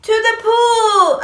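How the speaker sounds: high, slow yelled line; child yelling. Acted. Boy repeats fireman's call, high slow yell. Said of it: "I yelled".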